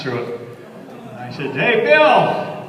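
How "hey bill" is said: calling out. Retold or imitated. Quoting himself calling to Bill.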